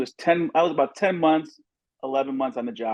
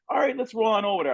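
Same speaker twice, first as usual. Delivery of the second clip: quick, high, casual; mock breezy officer; breezy cop; 9 semitones higher, faster than usual. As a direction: toss it off lightly, fast and high. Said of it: "I'm like".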